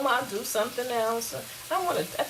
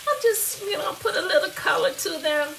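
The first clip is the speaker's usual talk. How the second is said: higher, louder and eager; pleased with the browning; cheerful lift in the voice; excited; 11 semitones higher, 5 dB louder than usual. Real, not acted. Showing potatoes browning.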